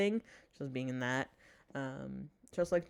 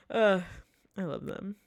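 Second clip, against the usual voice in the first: normal talking voice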